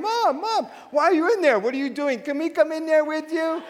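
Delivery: put-on high-pitched pestering kids; imitated crying children. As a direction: plead like kids outside a locked door. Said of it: "bang in the door crying".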